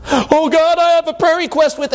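high, pleading prayer voice; pleading believer. Retold or imitated. Pastor mimics a believer praying to an upset God, pleading. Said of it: "God is just cranky with you".

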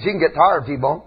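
normal talking voice

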